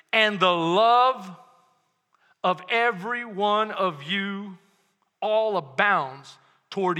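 love drawn out slowly; slow emphatic reading. Shown as a demonstration. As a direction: linger on love. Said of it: "Watch this".

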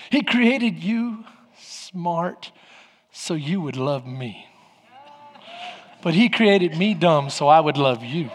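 low, slow, sweetly teasing punchline; sly gentle comeback. Retold or imitated. Wife answering husband's insult, low teasing calm. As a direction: let it land slowly and softly.

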